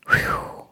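long high exhaled whew; a sharp relieved whew. Real, not acted. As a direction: blow out the tension. Exhale after tense memory.

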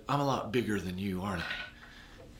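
soft coaxing, the last words almost breathed; soft reassurance to a child. Retold or imitated. Comforting a scared crying child.

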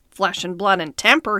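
usual speaking voice

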